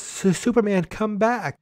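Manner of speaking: mock pleading Lois. Lois left on the roof, mock pleading. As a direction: play a swooning heroine calling after him. Lois longs for departing Superman.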